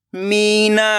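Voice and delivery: Meena joined as one word with long vowels; blended word. Shown as a demonstration. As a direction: read Meena whole, stretching the मी. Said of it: "खींच के पढ़ना है".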